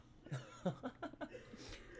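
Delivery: airy high giggle; a high breathless laugh of disbelief; high breathy laugh. Real, not acted. Laughing at own nosebleed.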